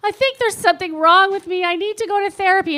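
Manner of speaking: mildly hammed-up worried admission; retold anxious confession. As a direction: imitate yourself pleading with mom, pitch lifted. Acts out younger self confiding in mom.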